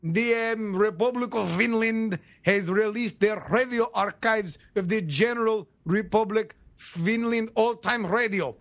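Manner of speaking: put-on stilted Russian-accented ad read; announcer with a mock Russian accent. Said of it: "listen to this interview in a Russian accent".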